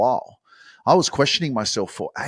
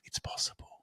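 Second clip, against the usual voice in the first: breathy whispered affirmation; 15 dB quieter than usual. Shown as a demonstration. Host leading listeners in an affirmation, whispered. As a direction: breathe it out as a secret. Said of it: "Just say that with me".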